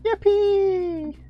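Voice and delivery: high, loud sarcastic yippee; mock cheer. Mock cheer about replaying level.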